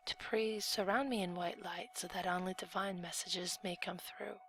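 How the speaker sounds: hushed, reverent whispered prayer; soft prayer that starts as a whisper and stays hushed. Real, not acted. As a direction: whisper a quiet plea to the angels. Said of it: "I would just like to do a quick prayer".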